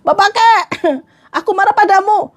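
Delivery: high-pitched pretend scolding; mock anger. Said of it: "kalau saya lagi marah". (pura-pura marah dengan nada tinggi)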